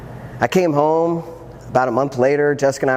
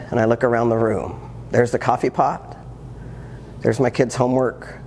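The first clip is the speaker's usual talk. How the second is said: ordinary delivery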